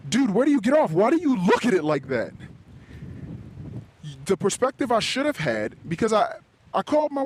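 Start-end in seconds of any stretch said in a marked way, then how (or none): 0.1-2.3 high, punchy disbelief; indignant "what is wrong with you" delivery; incredulous scolding. Acted. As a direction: call yourself out, pitch jumping up. Scolds himself in mock disbelief.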